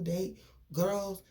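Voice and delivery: ordinary talk, nothing marked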